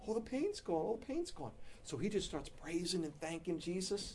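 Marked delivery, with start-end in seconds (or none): 0.1-1.5 relieved amazed cry. Retold or imitated. Wife relieved, pain gone.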